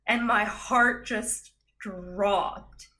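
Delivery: usual speaking voice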